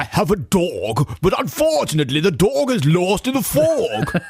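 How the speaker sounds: high, hammed-up toff voice, fog and coffee rounded; mock posh British. Host riffing on the coach's sentences in mock posh accent. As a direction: camp up the Lord Snooty accent. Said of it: "started talking like that".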